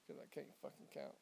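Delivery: whispered aside. Real, not acted. Counting under breath.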